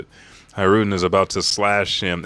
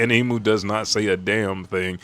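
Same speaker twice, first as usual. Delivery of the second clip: ordinary delivery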